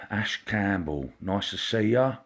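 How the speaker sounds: ordinary talk, nothing marked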